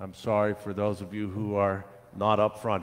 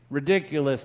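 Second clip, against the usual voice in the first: higher, emphatic scoff; declaring; 5 semitones higher, 5 dB louder than usual. A narrator's reading. Gardener rejects the children's plea, emphatic declaring. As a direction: declare it dismissively. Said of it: "the gardener declared".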